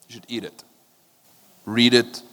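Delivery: usual speaking voice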